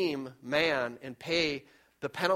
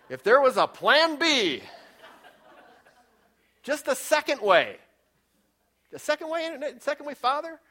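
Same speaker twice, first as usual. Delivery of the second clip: pleading cry; 9 semitones higher, 5 dB louder than usual. Retold or imitated. Imagining Jesus begging for plan B.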